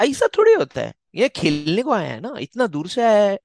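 high, loud, rapid-fire objection; mock indignant protest. Co-host mocking warnings to a player who flew in, indignant.

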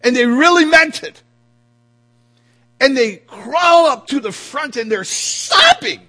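high, loud, stretched-out exclaiming; impassioned preaching shout. Real, not acted. Preacher describing a congregant crawling forward sobbing, impassioned shout. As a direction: shout it with mounting fervor. Preacher heightens imagined outcry scene.

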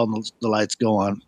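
ordinary talk, nothing marked

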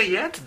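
"yet" con la y inicial clara y la t final marcada; pronunciación de yet. Shown as a demonstration. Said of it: "y se pronuncia así". (el profesor muestra cómo se pronuncia "yet")

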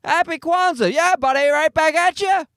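high, friendly mock-cheerful greeting; pretend-jovial holiday well-wisher; cheery. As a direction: beam at the stranger like a pal. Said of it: "I'm like".

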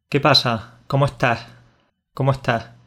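Andalusian "¿Cómo estás?" with the final s dropped, "cómo está". Shown as a demonstration. Teaching a friend the Andalusian way, the s swallowed. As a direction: drop the s at the end of "estás" the Andalusian way. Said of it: "diríamos algo así como".

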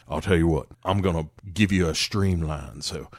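put-on low drawling old mechanic; old Southern man voice. Imitating Robert Duvall talking to car.

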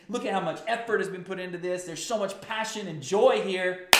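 high, loud, jubilant celebrating crowd; exultant, cheering praise; reliving the excitement. Retold or imitated. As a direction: sweep them back into the joy of the procession. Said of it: "you remember how you were feeling?".

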